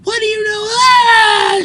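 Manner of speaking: high, loud crying shout; yelling. Retold or imitated. Woman unravels, yelling at hotel manager. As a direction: scream it through tears at him. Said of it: "she started yelling at me".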